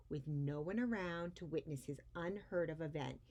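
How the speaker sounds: put-on lively read-aloud voice for children; expressive storybook reading; story narration. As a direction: read it like a picture book to a class. Reads picture book aloud to kids.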